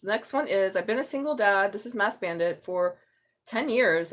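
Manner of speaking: ordinary talk, nothing marked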